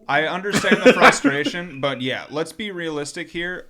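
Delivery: laughing, high and loud; chuckling concession. Real, not acted. Repeats phrase laughing.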